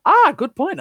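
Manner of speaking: sudden loud, very high exclamation; high-pitched. Real, not acted. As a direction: blurt it out in a squeaky high voice. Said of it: "why I was so high-pitched".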